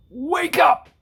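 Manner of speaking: very loud, high shout; loud yell. A narrator's reading. Principal yelling at sleeping Amy, a loud shout. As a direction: blast it at her full force. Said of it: "yelled at Amy as loud as she could".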